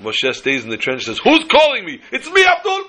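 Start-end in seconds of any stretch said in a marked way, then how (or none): 1.2-2.8 high, laughing call-and-answer; punchline calls. Retold or imitated. Joke punchline between the two trenches, high laughing shout. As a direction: shout it back through a laugh.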